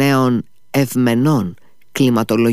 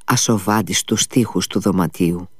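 plain reading voice

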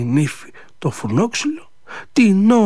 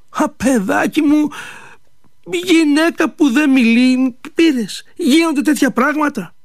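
put-on old mother; 8 semitones higher than usual. Old mother answers her son.

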